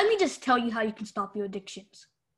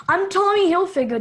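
loud, higher, proudly announcing a fake name; mock grand self-introduction; 6 semitones higher, 6 dB louder than usual. Kid vlogger introduces himself as Tommy Hilfiger, loud and proud. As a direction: boom it out like a celebrity. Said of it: "They have similar names".

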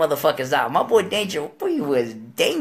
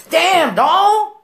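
high, loud wail of protest; dismayed protest; 11 semitones higher, 7 dB louder than usual. Acted. Storyteller sees another cousin knocked out, dismayed protest. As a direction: cry out in mock dismay.

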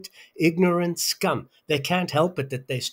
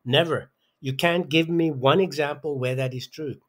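ordinary talk, nothing marked

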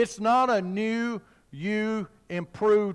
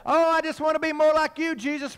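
higher-pitched churchgoer's plea; mock devotional plea; 7 semitones higher than usual. Preacher imitating churchgoers praying to Jesus, mock devotional plea. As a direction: lightly imitate an earnest worshipper.

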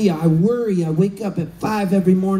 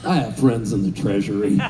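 much lower, mock-serious voice; Woz deadpan; 13 semitones lower than usual. Tech legend telling the comic a secret, low and dry. Wozniak answers about two-dollar bills.

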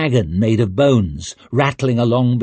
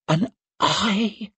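frightened high question; 9 semitones higher, 5 dB quieter than usual. Acted. Frightened disbelief.

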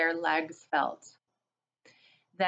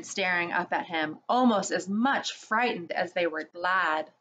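usual speaking voice

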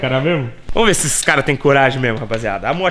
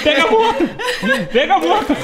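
excited shout; 13 semitones higher than usual. Real, not acted. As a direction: cheer him on at full voice. Cheering friend going down slide.

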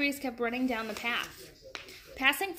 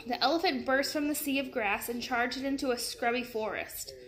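ordinary delivery

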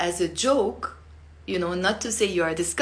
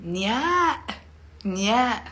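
disgusted nya; 2 semitones higher than usual. Shown as a demonstration. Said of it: "if you're disgusted".

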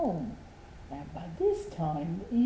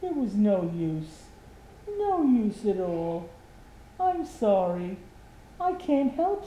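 sorry Mister Happy; 4 semitones higher, 5 dB louder than usual. Acted. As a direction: let him down gently, a touch sad. Mister Happy apologises sadly.

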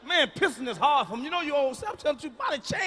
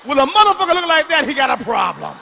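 much louder, higher outburst; loud punchline shout; 3 semitones higher, 10 dB louder than usual. Real, not acted. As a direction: hit the crowd with it full volume. Punchline about ugly nephew.

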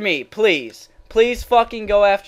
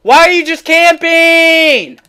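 high, drawn-out outraged scream; real gamer rage; 9 semitones higher, 10 dB louder than usual. Real, not acted. Gamer railing at a camping opponent, enraged. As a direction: scream it and stretch the last word. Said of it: "after raging that hard".

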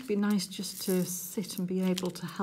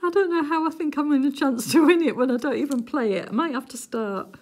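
laughing at herself; 7 semitones higher, 8 dB louder than usual. Real, not acted. Laughing at her own lottery logic.

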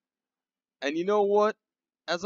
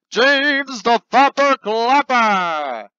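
slow, loud, higher announcer call; award announcement; 7 semitones higher, 9 dB louder, much slower than usual. Acted. Host announces snowflake award winner, slow and loud.

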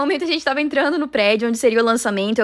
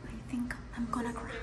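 whisper; 18 dB quieter than usual. Real, not acted. Moved whisper during the talk.

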